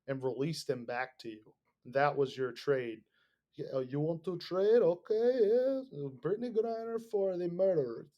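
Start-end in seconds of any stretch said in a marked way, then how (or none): 3.6-8.1 squeaky high mocking voice, suddenly higher on Okay; hammed-up gleeful deal-maker; mock Russian negotiator. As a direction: sneer the offer in a silly high voice. Mocking Russian side of the trade.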